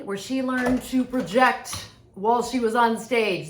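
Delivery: big booming stage delivery; projected stage voice. Shown as a demonstration. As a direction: project it out to the back of the theater. Demonstrates the stage projection described.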